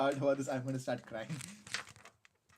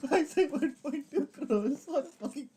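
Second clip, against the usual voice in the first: higher, through giggles; laughing; 10 semitones higher, 4 dB louder than usual. Real, not acted. Streamer mocking his costly degree, laughing through words. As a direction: laugh while scolding yourself.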